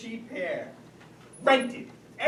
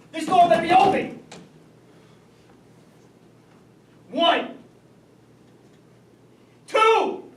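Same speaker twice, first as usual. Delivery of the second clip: louder and higher with each count; furious father counting to three; angry ultimatum; 7 semitones higher, 10 dB louder than usual. Acted. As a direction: threaten her, counting up in anger. Father threatening daughter through door.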